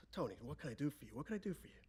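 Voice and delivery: very soft, unhurried star's voice; De Niro. Retold or imitated. Movie star asking the father what he wants, hushed. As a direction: quietly imitate a patient movie star.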